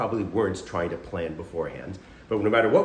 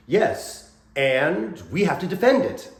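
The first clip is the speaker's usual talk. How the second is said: higher-pitched, eager stage agreement; rallying acted reply; 7 semitones higher, 4 dB louder, slower than usual. Improviser accepting a partner's land claim, rallying reply. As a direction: take up the offer with gusto.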